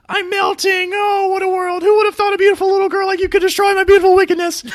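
loud, very high falsetto witch shriek; Wicked Witch of the West. Acted. Co-host attempts the witch's line in real time, falsetto witch voice. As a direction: screech it in falsetto, racing through a dying villain's melting speech. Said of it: "falsetto Wicked Witch voice".

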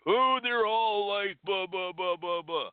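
mock jaded whining. Mocking what a cynic says.

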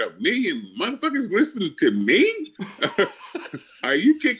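high, laughing amazement; laughing disbelief. Retold or imitated. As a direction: laugh it out, stunned he went viral. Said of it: "I said".

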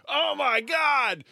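high, slow whiny mock of online outrage. Mocking Twitter users freaking out over a commercial, high and slow. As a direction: drawl it like a hysterical tweet.

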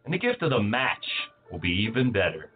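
ordinary talk

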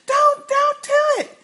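high mock scream; high-pitched pleading scream; screaming Lizzie. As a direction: shriek it like a panicked child. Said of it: "And Lizzie's screaming".

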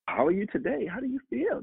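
put-on high-pitched, chatty little-girl voice; high singsong kid impression; imitating daughter. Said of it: "This is how she's talking to me".

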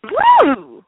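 loud, high happy whoop; excited cheer. Real, not acted. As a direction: cheer it out with joy. Cheering a friend's new gift.

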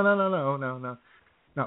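usual speaking voice

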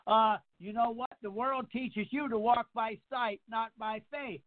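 usual speaking voice

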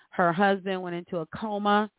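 ordinary delivery

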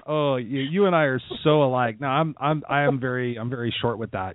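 usual speaking voice

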